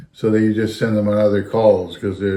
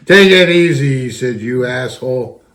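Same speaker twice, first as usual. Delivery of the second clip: dad snapping at being slammed into the wall; angry snap; 3 semitones higher, 5 dB louder than usual. Retold or imitated. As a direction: bark it at your son as he shoves you. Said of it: "Like that".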